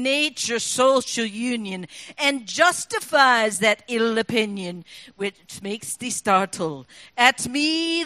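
Burns read in broad Scots, slow and rolling; Scots poem recital. Pretend. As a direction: recite it like a Burns Supper toast. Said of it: "it goes like this".

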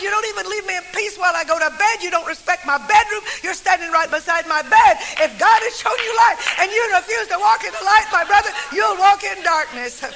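hammed-up indignant outburst; indignant rant building. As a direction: rise from a grumble to a near shout. Man angrily repeating the warning.

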